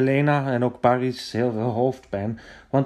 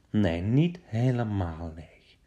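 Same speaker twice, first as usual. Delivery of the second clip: ordinary delivery, nothing marked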